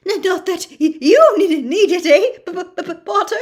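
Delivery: a jittery teacher tripping on every word; nervous stammer. Acted. Quirrell keeps stammering.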